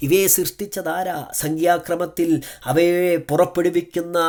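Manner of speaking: a preacher raising the voice to the congregation; fervent preaching. Acted. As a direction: preach it out with full fire. Preaching at full force.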